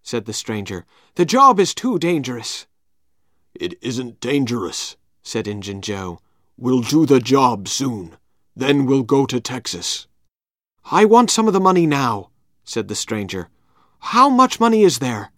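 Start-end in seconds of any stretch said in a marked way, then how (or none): 1.1-2.7 higher, urgent warning; stranger's voice. Acted. Stranger argues. 3.5-10.1 calm menace, taking his time; Injun Joe voice. Acted. 10.8-12.3 higher, louder demand; stranger demanding. Acted. 14.1-15.3 high, loud greedy question; eager stranger. Acted.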